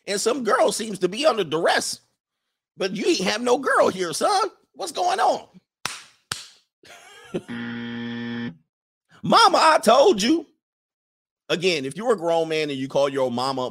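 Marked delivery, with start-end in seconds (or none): 0.1-5.5 very high, puzzled mother voice; suspicious mama. Acted. Parent questions son in acted scene. 9.2-10.5 high, loud mock whine to mama; protesting son.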